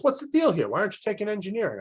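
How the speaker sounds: high, fast imitated interrogation; grilling nun. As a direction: press the student like a tough counselor. Tough nun grills the student.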